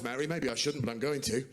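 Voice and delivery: usual speaking voice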